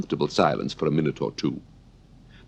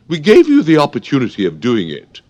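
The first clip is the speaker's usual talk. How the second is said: high, loud, lofty royal voice; Red Queen; 9 semitones higher, 10 dB louder than usual. Acted. Red Queen lecturing Alice on manners, high loud and lofty. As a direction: talk down to the girl like a haughty queen. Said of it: "the Red Queen remarked".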